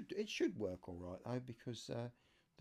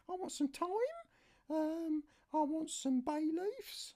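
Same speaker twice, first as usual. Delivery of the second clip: much higher falsetto niece voice; niece's voice. Shown as a demonstration. Niece listing herbs for her uncle, high falsetto. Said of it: "wrong voice".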